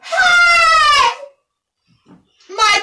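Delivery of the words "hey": very high loud cry; queen hearing the princess called by name, outraged shout. Acted.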